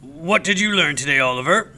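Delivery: a knowing teacher's question; pointed teacher question. A narrator's reading. Teacher quizzes boy.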